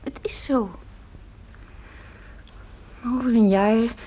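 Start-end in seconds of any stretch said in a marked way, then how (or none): 0.0-0.7 soft knowing whisper. Acted. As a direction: whisper it like a secret. Mother hushes knowingly.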